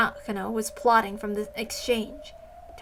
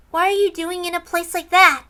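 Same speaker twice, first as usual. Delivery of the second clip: put-on child's voice; 7 semitones higher, 6 dB louder than usual. Misread line, then retaken.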